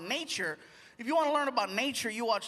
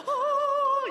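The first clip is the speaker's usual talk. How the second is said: awed gasp; 14 semitones higher, 3 dB louder than usual. Retold or imitated.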